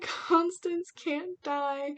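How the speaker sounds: slow, high, drawn-out wail; emotional wail. Retold or imitated. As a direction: plead it as if your heart is breaking. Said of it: "I was getting emotional".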